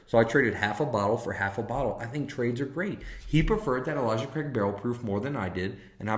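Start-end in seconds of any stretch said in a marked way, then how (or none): none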